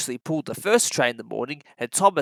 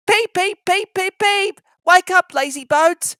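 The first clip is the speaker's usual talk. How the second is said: high, loud piping little engine; shrill it out like a cheeky little engine tooting; cheeky engine whistle; 13 semitones higher, 7 dB louder than usual. Acted. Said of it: "he would whistle".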